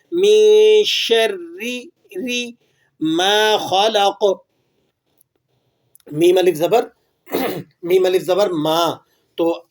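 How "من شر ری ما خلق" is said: شر with a light ر, very slowly; thin ra. Shown as a demonstration. Teacher shows the thin ra to students. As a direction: keep the ر in شر thin and slow. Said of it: "را کو باریک پڑھ رہے ہیں".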